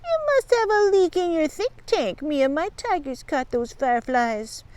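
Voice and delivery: high, mocking bully voice; sneering. A narrator's reading. Bugs denies stealing the can, sneering. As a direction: jeer it high, settling as you boast. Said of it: "Bugs sneered".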